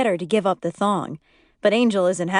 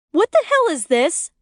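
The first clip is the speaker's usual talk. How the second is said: high, loud furious yell; 9 semitones higher, 4 dB louder than usual. A narrator's reading. Angry wife yelling at her husband. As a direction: yell it at him in shock and rage. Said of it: "she yells".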